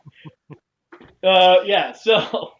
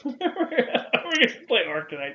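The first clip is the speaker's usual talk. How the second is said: laughing; 9 semitones higher, slower than usual. Real, not acted. Joking suggestion, laughing.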